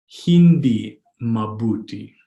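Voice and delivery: hindi mabuti drawn out slowly; slow, word by word. Shown as a demonstration. Teacher modelling "not good" in Tagalog, slow and separated. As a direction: sound out "Hindi mabuti" for learners.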